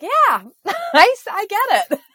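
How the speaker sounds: high, loud laughing agreement. Real, not acted. Laughing agreement after reading.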